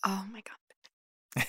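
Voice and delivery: slow breathy whisper of awe. Acted. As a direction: barely breathe it out, overwhelmed. Overwhelmed romantic whisper.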